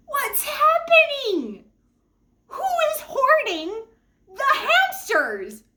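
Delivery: high, slow, frantic disbelief; high drawn-out exasperated cry; exasperated outcry. Retold or imitated. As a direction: wail it out in mock despair. Frantic retold outburst over hamsters.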